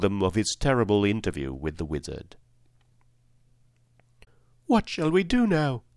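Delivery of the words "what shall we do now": put-on high, girlish voice with a touch of sadness; Dorothy, sad. A narrator's reading. Dorothy sadly asks her friends what to do, high and plaintive. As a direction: pitch it up like a downcast little girl. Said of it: "asked Dorothy sadly".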